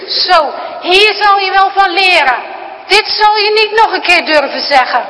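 loud, high, menacing threat; angry grandpa. Acted. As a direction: spit it out as a final warning. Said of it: "En hij sist nog".

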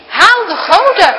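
loud, high, stern order; grandpa's stern command. Acted. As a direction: bark the order again. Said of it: "klinkt het opnieuw".

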